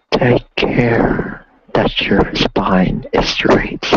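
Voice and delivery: slow, hushed meditation guidance. Acted. Leads a meditation.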